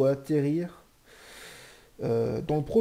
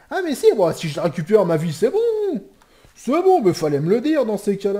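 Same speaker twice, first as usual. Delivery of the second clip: excited relief; 7 semitones higher, 10 dB louder than usual. Real, not acted. Relief about keeping life.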